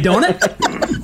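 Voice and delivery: put-on very high joke British accent; playful high British accent; mock British accent. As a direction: tag it on in a laughing, squeaky British accent. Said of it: "they break out a British accent".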